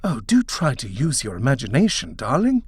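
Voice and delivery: bright eager coaxing, darling rising high; husband selling his wife on the house, excited. A narrator's reading. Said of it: "Edward said excitedly".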